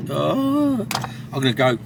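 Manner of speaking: talking through hiccups. Real, not acted. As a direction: speak while hiccuping. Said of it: "oh, I've got hiccups now".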